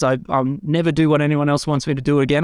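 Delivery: normal talking voice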